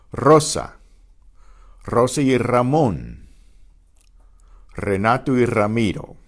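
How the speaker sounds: Rosa, Ramón, Renato, Ramiro with rolled first R; Spanish names Rosa, Ramón, Renato, Ramiro, R trilled; trilled initial R. Shown as a demonstration. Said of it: "The initial R is also".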